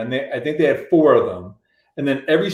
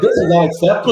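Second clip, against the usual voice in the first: raised, mock-petulant complaint; babyish tantrum; 5 semitones higher, 4 dB louder, faster than usual. Musician mocks himself protesting to the label, babyish. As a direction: protest like a big baby, playfully overdone. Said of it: "I was being such a baby".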